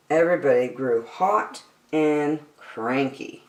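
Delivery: ordinary delivery, nothing marked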